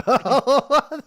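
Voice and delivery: high, loud laugh; real high burst of laughing; loud laughter. Real, not acted. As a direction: crack up loudly at yourself. Laughs at own gaming joke.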